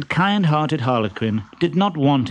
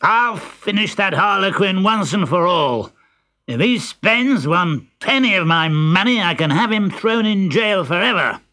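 high-pitched vengeful outburst; angry threat; 8 semitones higher than usual. A narrator's reading. Pantalone vows to have Harlequin jailed, furious. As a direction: rant the threat in a raised, heated voice.

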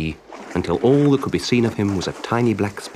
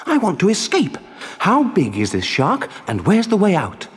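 put-on high, anxious child-puppet voice; frantic puppet; 11 semitones higher than usual. Pinocchio asking the tuna for a way out, high and anxious. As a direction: plead and question in a high, urgent voice.

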